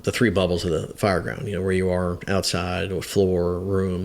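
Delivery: ordinary talk, nothing marked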